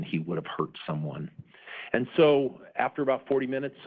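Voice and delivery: ordinary talk, nothing marked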